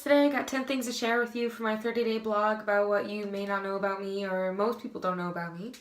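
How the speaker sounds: ordinary talk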